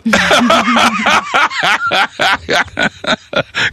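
high burst of laughter. Real, not acted. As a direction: break into laughing. Laughs at caller's answer.

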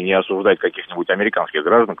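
ordinary talk

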